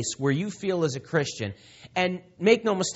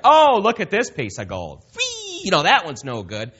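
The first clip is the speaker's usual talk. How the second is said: higher, louder, flippant "whee"; careless finder; 5 semitones higher, 5 dB louder than usual. Retold or imitated. God pictured tossing away his treasure, hammed-up flippant. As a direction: toss the gold away like a careless fool. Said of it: "was like".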